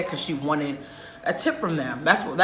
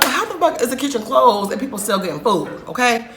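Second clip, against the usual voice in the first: indignant outburst; 6 semitones higher, 4 dB louder than usual. Retold or imitated. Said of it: "I'm like".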